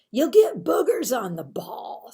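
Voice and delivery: whispered schoolyard taunt; loud whispered jeer at a classmate; mocking whisper. A narrator's reading. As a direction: hiss it like a sneering kid. Kid taunting Marvin at recess.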